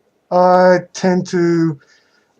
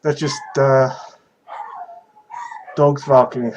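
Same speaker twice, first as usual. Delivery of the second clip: ordinary talk, nothing marked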